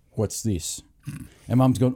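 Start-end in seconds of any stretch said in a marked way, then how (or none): 0.1-0.8 low, quiet retold grumble; soft, low imitation of a displeased father; unimpressed dad. Dad questions mum's ribs, low mock displeasure. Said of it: "He's gone".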